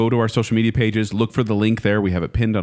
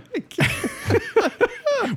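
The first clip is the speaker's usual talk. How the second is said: high laugh; 3 dB quieter than usual. Real, not acted. [name] laughing at their accent habit, high laugh.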